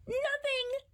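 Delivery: high startled yelp. Acted. Jasper answers his mom with a startled yelp. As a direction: squeak it out in panic. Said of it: "he yelped".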